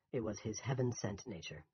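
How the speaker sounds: ordinary delivery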